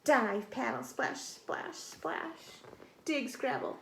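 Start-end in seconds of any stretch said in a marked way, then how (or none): 0.8-2.6 soft hushed splashes; hushed splashing sounds; whispered splash. A narrator's reading. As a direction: whisper it like tiny paddling paws.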